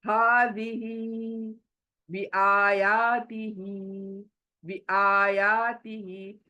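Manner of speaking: words said slowly with the long vowels and final ही held. Shown as a demonstration. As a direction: stretch the marked vowels and the last ही. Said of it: "और ये आ खींचना है". (लंबी मात्राओं को खींचकर, धीरे)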